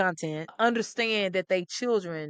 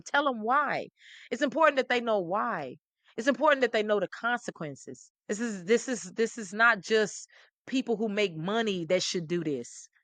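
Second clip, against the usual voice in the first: ordinary talk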